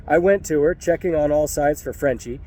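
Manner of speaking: ordinary delivery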